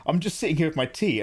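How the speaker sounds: ordinary delivery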